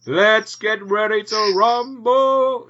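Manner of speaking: drawn-out hammed-up boxing announcer; ring announcer. Mock ring announcer hyping the fight for his co-host.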